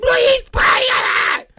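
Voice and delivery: put-on very high, loud child shriek; kid's outraged shout. Son yelling at his little brother about the tree, shrill shout. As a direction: scream it like a furious little boy.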